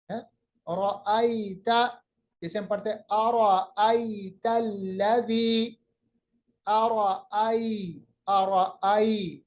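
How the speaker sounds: अरअई slow, ai drawn soft; soft drawn vowel. Shown as a demonstration. Teacher models अरअई slowly. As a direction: stretch the ai of अरअई gently. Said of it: "या को मुलायम आवाज कीजिएगा".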